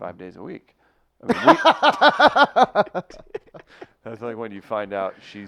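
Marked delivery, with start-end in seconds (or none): 1.3-4.0 high, drawn-out loud laugh; exaggerated laugh. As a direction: stretch it into a big hammy laugh. Mock dramatic reaction.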